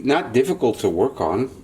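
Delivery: usual speaking voice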